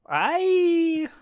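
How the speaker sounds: high shrill mock scream as Lila; Lila impression. Reading the book's scream, a high mock squeal. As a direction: squeal it like a melodramatic teen. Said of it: "That's my Lila impression".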